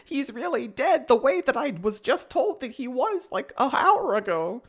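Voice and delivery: high, whiny Aang voice; high mock Aang. Aang at Gyatso's skeleton, high mock shock. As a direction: gasp it high and melodramatic. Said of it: "And he's like".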